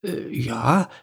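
hesitant, slow and faltering; small voice, uncertain; a bit unsure. A narrator's reading. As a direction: lose confidence mid-answer. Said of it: "ein bisschen verunsichert".

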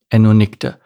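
plain reading voice

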